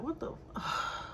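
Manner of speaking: whispered curse. Real, not acted. Fed up with her boyfriend, whispering the curse to herself. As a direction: swallow the swear under her breath.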